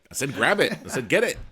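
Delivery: defensive, retold with comic emphasis; insistent protest. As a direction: protest your innocence, punching the key verbs. Acted back-and-forth argument over a word.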